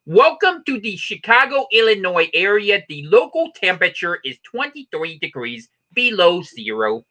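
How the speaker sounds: put-on a Chicago announcer accent, slow; lightly voiced Chicago announcer in Dan Aykroyd style; Dan Aykroyd impression. Welcoming visitors to Chicago, a Chicago announcer accent. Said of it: "such a good Dan Aykroyd".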